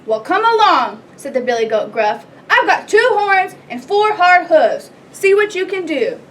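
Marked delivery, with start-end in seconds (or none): 0.0-1.0 big goat's loud, raised challenge; loud as a troll. A narrator's reading. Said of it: "as loud as the troll's". 2.5-6.2 loud, defiant billy goat; loud as a troll. A narrator's reading. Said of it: "as loud as the troll's".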